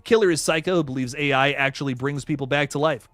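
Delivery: usual speaking voice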